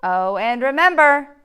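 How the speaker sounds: loud, friendly bus driver voice; booming instructions from the driver. Acted. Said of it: "The bus driver says".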